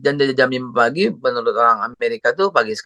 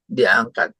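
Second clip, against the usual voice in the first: usual speaking voice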